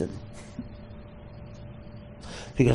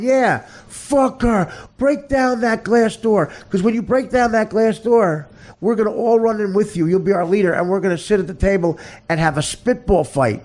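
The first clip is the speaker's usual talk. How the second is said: excited fans; 7 semitones higher than usual. Acted. Stupid fans urge their leader through the glass door, excited. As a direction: egg the hero on with rising zeal.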